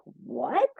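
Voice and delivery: high, rising yelp of disbelief; shocked reaction. Retold or imitated. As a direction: re-enact being stunned by the news. Her shocked reply to bad news.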